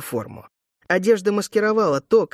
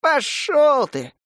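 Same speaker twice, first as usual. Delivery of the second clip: ordinary delivery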